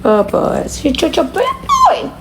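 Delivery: mock-scold the pup in a squeaky coo. Real, not acted. Owner cooing at her dog, high baby-talk mock scold.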